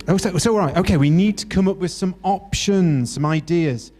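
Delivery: ordinary talk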